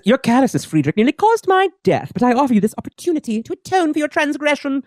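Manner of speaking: high, imperious villainess; Selene. Acted. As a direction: pronounce it grandly to a failed servant. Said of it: "Selene says".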